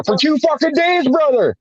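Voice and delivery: high incredulous vent to a friend; indignant outburst. Retold or imitated. Outraged retelling of the discharge.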